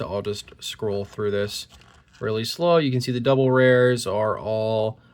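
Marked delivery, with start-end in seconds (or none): none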